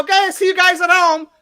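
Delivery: high, loud, joking goodbye; light-hearted send-off. Retold or imitated. Comic to ejected hecklers as they leave, joking send-off. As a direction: call it out cheerily as a joke. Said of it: "I lightened it up".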